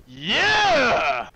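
long loud triumphant yeah; hype yell. Real, not acted. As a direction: celebrate the gains. Cheering gains.

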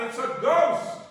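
high, loud mock cry of alarm; frightened cry. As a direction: cry out as the terrified disciples. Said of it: "they cried out".

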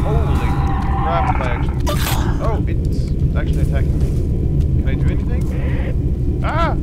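loud, high frightened shouts; terrified gamer yelling at the screen; panicked yelling. Real, not acted. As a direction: scream in real panic as it attacks. Attacked by a creature in game.

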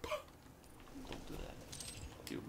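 tiny breathy whisper; whispered whine. Pretend. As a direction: whisper it like a hurt child. Playful whine after being stumped.

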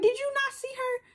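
very high-pitched disbelief; incredulous protest. Retold or imitated. Student arguing with teacher, high incredulous protest. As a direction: hold it up high, pleading innocence.

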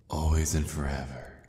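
ordinary talk, nothing marked

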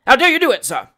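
loud, high, fast tirade; furious magistrate building to a shout; passionate rage. A narrator's reading. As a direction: berate the constable, outraged. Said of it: "reddening with passion".

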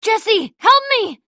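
high-pitched, desperate call; urgent cry for help. Acted. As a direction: call out for rescue, voice leaping up. Calling for help in danger.

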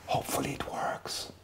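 hushed unvoiced aside; whisper. Real, not acted. Host whispering an aside while the poll loads, whispered. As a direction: breathe it under your breath.

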